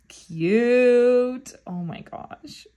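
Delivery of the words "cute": excited squeal. Real, not acted. Fan opening a photo card, squealing with delight. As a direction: gush over the card out loud.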